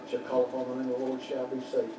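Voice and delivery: ordinary talk, nothing marked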